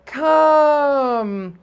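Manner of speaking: high, loud, sustained call; long drawn-out yell. A narrator's reading. Narrator gives the yell the boys heard, hollering. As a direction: holler it and hold the note. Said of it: "They heard a yell".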